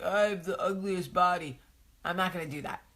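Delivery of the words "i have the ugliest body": whiny self-pity. Retold or imitated. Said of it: "I'm not gonna sit here and be like".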